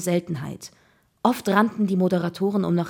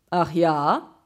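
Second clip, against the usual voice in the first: mocking, disbelieving question; scornful; 4 dB louder than usual. A narrator's reading. Guard disbelieving the boy's claim, scornful. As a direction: sneer it, doubting the boy. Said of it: "Der Aufseher lachte höhnisch".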